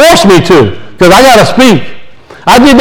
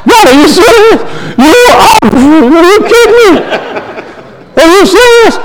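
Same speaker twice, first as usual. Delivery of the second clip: high-pitched comic disbelief; incredulous protest to God; 9 semitones higher than usual. Retold or imitated. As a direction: retell a laughing, exasperated plea to God. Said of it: "you know what I'm telling God?".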